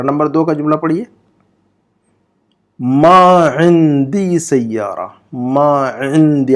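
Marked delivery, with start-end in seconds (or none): 2.8-6.5 मा इंदी said very slowly with a throaty ain at the start of इंदी; ain from the throat. Shown as a demonstration. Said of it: "ऐन की आवाज़ निकालिएगा". (इंदी में ऐन हलक से, बहुत धीमे)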